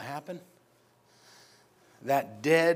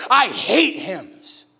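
slow drawn-out disdain; 7 dB louder, much slower than usual. Retold or imitated. Ladies in line sneering at the hymns, slow. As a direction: drag out I hate hymns, very slow. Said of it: "They said it just like that".

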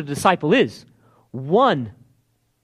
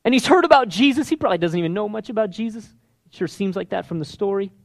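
ordinary delivery

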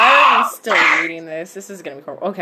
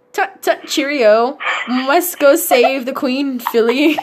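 higher, hammed-up British tut tut cheerio; mock posh British; 5 semitones higher, slower than usual. [name] in a top hat bidding Phil farewell, mock British accent. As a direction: camp it up like a stuffy Englishman. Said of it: "top hat and tea".

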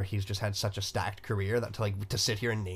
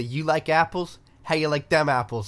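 higher, louder Boston-tinged quote; Boston movie quote; 5 semitones higher, 5 dB louder than usual. Retold or imitated. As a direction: belt the bar line with a Boston swagger. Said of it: "This movie is very Boston".

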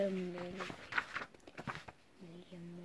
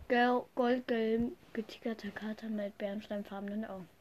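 whispering the clue; whispered clue; 8 dB quieter than usual. Real, not acted. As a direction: hush it like a secret hint. Flüstert den Hinweis. (geflüsterter Hinweis)

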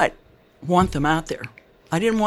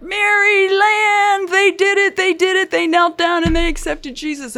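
high, slow, joyful Mississippi Southern drawl stretching Marilyn; the pastor's Southern Mississippi accent, singing out the good news; 13 semitones higher, 4 dB louder than usual. Retold or imitated. Southern pastor tells Marilyn her parents got saved, in a high, drawn-out Mississippi drawl. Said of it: "he was a Southern Baptist. He was from Mississippi, and he had".

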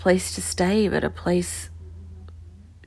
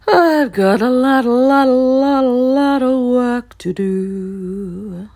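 overwhelmed cry; 5 semitones higher, 7 dB louder than usual. Real, not acted. Overwhelmed by the move.

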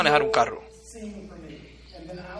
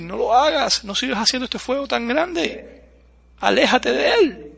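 warning voice; 7 semitones higher than usual. Retold or imitated. People warning against big fire.

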